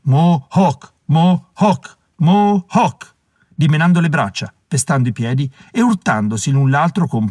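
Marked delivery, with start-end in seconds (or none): 0.0-3.1 chanted war cry, climbing in pitch; rhythmic cry, rising with each repeat; frenzied group shout. A narrator's reading. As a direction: chant it like a possessed gang. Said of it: "mentre tutti gridavano invasati".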